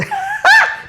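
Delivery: real laugh. Real, not acted. Laughs at hazmat suit answer.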